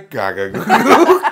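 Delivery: put-on slow, laughing baby babble. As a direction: babble slowly through laughter. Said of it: "Read the joke in a baby's voice".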